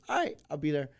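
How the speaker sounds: mock celebrity brushing someone off; Anthony Anderson. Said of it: "He goes".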